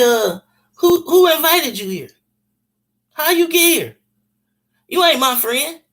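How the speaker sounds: scared, defensive. Retold or imitated. Scared shouting through door.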